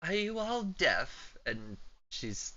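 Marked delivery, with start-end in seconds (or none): 0.0-1.4 put-on old woman voice.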